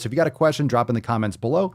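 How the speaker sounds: ordinary talk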